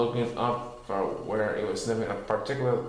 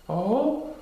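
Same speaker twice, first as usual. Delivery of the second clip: high curious Pooh voice; a perked-up little bear; 9 semitones higher than usual. A narrator's reading. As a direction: Pooh with sudden interest. Pooh's voice.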